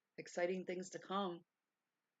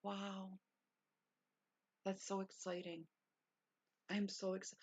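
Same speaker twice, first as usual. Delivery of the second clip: usual speaking voice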